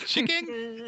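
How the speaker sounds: goofy high "Chicken?" while laughing; silly chicken again. Shown as a demonstration. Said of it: "I don't know why I'm saying chicken that way".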